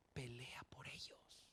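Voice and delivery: slow, breathy whisper with heavy weight; whispered plea. Real, not acted. Preacher urging parents, in a slow whisper. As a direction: whisper it like a secret charge.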